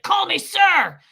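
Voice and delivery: high, loud outraged yell; mad. Retold or imitated. Angry official demanding his title from the receptionist, mad yell. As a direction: yell it like an offended bigwig. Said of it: "he was so mad".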